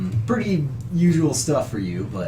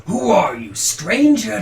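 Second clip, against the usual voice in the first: raised, loud priest's challenge; challenging priest; 7 semitones higher, 5 dB louder than usual. Acted. Priest confronts a stranger come to town, loud challenge. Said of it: "the priest starts walking down".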